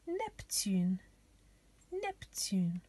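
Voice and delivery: "Neptune" said the French way, nep-TÜN; French pronunciation. Shown as a demonstration. Teacher giving children the French planet name, "Neptune" pronounced nep-TÜN. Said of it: "names of the planets in French".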